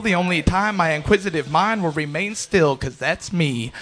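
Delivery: driving, rhythmic slam poem with punched words; spoken-word poetry. Acted. Poet describing a racing mind, driving spoken-word performance. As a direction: perform it as relentless rhymed verse.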